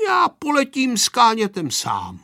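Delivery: high, determined announcement; declaration. A narrator's reading. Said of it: "prohlásil Pepíček".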